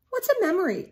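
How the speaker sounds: put-on high, curious little boy voice; child's question. Small boy asking his father. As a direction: ask it like an eager small boy. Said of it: "asked Wilfred Gordon".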